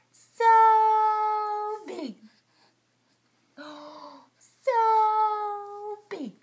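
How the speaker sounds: loud, high cheer; loud delighted so big; excited so big. Real, not acted. So big" game with baby.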